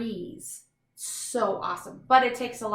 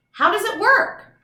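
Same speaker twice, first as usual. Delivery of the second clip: much higher, louder rising question; excited heading; 10 semitones higher, 9 dB louder than usual. Acted. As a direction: spark the kids' curiosity. Chapter heading read aloud.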